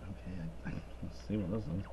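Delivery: quick hushed muttering; muttered to himself. Retold or imitated. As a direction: murmur it under your breath, hurrying. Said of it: "I'm like".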